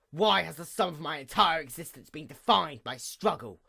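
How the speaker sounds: ordinary delivery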